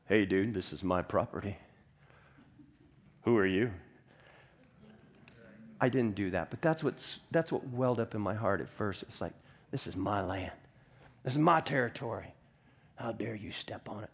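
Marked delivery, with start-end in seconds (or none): 0.1-3.7 low-pitched mock tough-guy challenge; territorial challenge. Acts out his first territorial reaction. 9.7-14.1 lower-pitched, understated tough-guy indignation; mock territorial indignation. Mock territorial anger welling up inside.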